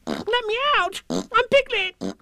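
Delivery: very high squealed plea; frantic tiny animal voice; crying-out Piglet. Acted. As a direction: squeal to be let out. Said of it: "cried Piglet".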